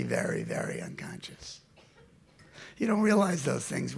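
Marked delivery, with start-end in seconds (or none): 0.0-1.6 whisper. Real, not acted. Trails into whisper on unconscious.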